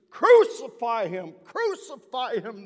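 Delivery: slow, very loud, high crowd scream; frenzied mob screaming crucify. Retold or imitated. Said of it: "they screamed at me".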